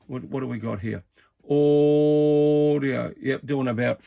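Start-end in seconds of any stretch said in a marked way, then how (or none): 1.4-3.1 drawn-out audio check into the mic; radio test call. Real, not acted. Testing his CB transmit, drawn-out radio check call. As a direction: call it out slow like keying a CB.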